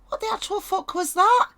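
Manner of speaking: high, stunned squeal of disbelief; a card figure reeling at what just hit her. Pretend.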